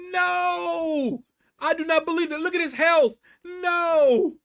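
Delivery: high, loud disbelieving scream. Retold or imitated. Gamer reliving his scream at the enemy's health, loud disbelief. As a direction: scream it like you can't believe he lived. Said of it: "At this point, I am screaming".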